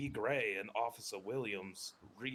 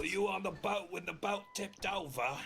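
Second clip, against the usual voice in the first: put-on British accent; 10 semitones higher than usual. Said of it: "Am I doing it good?".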